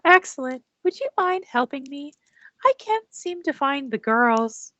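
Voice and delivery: high-pitched, politely put-on character voice; polite character asking help. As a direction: ask sweetly in character, pitched well up. Game master speaks as character.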